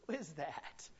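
normal talking voice

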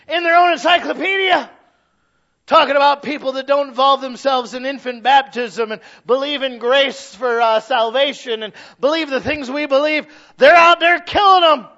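high, loud preacher's shout; full-force sermon shout building; shouted preaching. Acted. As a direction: hammer it home from the pulpit.

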